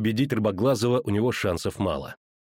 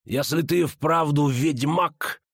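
slow, higher villain's snarl; through gritted teeth; 4 semitones higher, much slower than usual. A narrator's reading. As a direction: grind it out slowly through clenched teeth. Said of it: "скрежетнул он зубами". (сквозь зубы, медленно)

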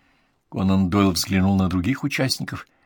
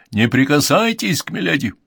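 raised, urgent prohibition; shouted warning; 6 semitones higher, 4 dB louder than usual. A narrator's reading. Lord stops doctor touching milady, shouted warning. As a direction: call out a forceful warning. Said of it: "крикнул лорд Уэбб".

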